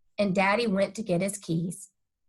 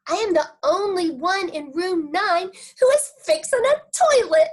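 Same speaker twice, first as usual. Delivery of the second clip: louder, higher proud announcement; proud little girl; 9 semitones higher, 6 dB louder than usual. Acted. Junie B proudly telling Grandpa her news. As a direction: brag it with delight.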